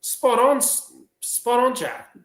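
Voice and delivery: high, slow schoolteacher praise; high-pitched teacher's approval; teacher praising. Acted. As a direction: gush over a pupil, drawn out and high. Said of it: "She goes".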